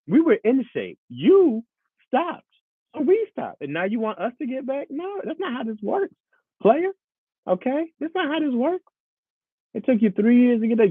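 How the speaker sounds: high, sassy telling-off voice; scolding body. Acted. Body scolds its owner for quitting, high and indignant. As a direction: scold him in a high, fed-up squeak. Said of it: "My body's like".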